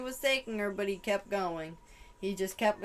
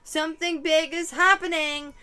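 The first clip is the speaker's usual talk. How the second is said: louder, higher yelled repeat; imitated yell; 7 semitones higher, 7 dB louder than usual. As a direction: yell it the second time for real. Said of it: "someone yelling".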